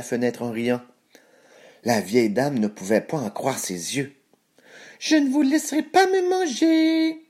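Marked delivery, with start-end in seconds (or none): 5.0-7.2 very high, squeaky little cookie voice; gingerbread man. A narrator's reading. Gingerbread man escaping through the window, high defiant voice. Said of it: "dit-il en se sauvant".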